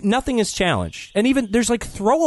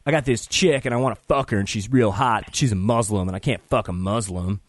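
dumb bro; 7 semitones lower than usual. Retold or imitated. Cohost mocks the film's conservative guy, a lower dim-bro voice. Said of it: "The conservative guy's like".

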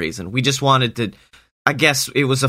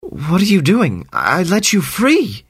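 much higher, dismayed outcry; upset disbelief; 7 semitones higher than usual. Acted. Sammy confronting the freed snake, much higher and dismayed. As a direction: cry out in hurt disbelief, pitched way up. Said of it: "he goes".

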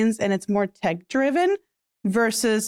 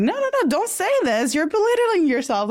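high-pitched retold objection; urgent protest; 8 semitones higher than usual. Coach replays her reply to a self-doubting client, high protesting. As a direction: jump in high to stop them, acted out.

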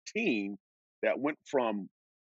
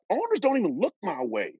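ordinary delivery

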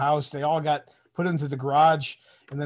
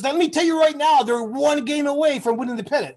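higher, louder mock yell at a cocky recruit; retold yelling; 11 semitones higher, 5 dB louder than usual. MP yelling at the driver about the Tigers, mock yell. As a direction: shout it like an MP defending his team. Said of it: "he starts yelling at me".

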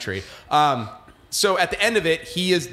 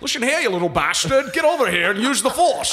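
gruff Connery Obi-Wan; 8 semitones higher than usual. Pretend. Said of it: "He would have been like".

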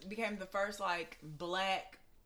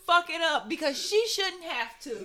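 high, loud, excited retelling; fired-up shouting; 9 semitones higher, 17 dB louder than usual. Real, not acted. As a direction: shout it over the room. Excitedly shouting own defiant tweet.

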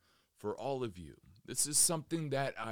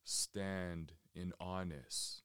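measured, settled delivery, letting each phrase hang in silence; guided meditation; 3 semitones lower than usual. Real, not acted. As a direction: guide listeners calmly inward, phrase by unhurried phrase.

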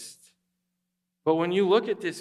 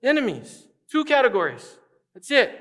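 higher and louder, emphatic declaration; forceful divine correction, rising louder to the end; God's loud insistence; 6 semitones higher, 6 dB louder than usual. Retold or imitated. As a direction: hammer the two categories home. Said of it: "He's like".

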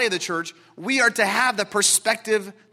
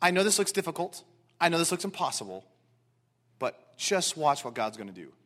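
calm trust; 4 semitones lower, 6 dB quieter than usual. Retold or imitated. Trusting soul answers the problem.

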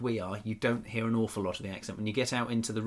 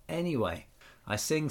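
ordinary talk, nothing marked

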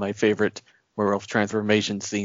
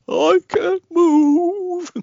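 high, slow Pooh bear impression; Winnie the Pooh voice; 6 dB louder, much slower than usual. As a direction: squeak it out like Pooh, stretched. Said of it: "sounded like Winnie the Pooh".

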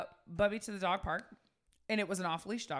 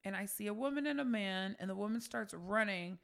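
ordinary delivery

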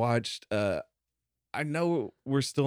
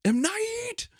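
squeal. Acted. Punchline on M. Night name.

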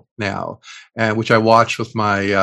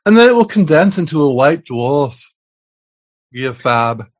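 bad fake Liverpudlian accent, higher swooping pitch; 3 semitones higher, 4 dB louder than usual. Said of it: "try to do it like [name]".

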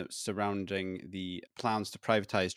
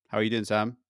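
ordinary delivery

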